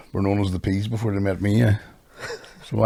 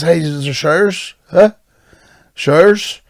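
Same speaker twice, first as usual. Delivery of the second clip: "Showers?" asked again and again, rising and louder; repeating showers; 6 semitones higher, 3 dB louder, slower than usual. Retold or imitated. Belfast boxer asking trainers about showers, repeating insistently. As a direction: keep pushing the question higher each time. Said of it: "four times I said showers".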